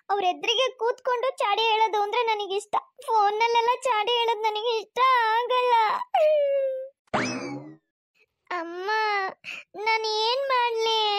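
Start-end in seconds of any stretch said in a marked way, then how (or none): 3.1-11.2 tearful wailing. Acted. Bride complaining to her mother as she leaves, tearful wailing. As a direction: wail it, stretching the last words into sobs. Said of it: "ಅಳ್ಬಾರ್ದು".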